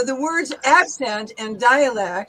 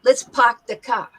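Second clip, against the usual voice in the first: louder New York accent, R's dropped in park and car; a New Yorker's R-less park and car; 5 dB louder than usual. Shown as a demonstration. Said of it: "But a New Yorker would say".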